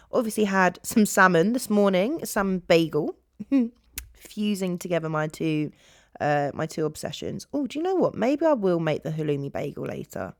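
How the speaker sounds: ordinary talk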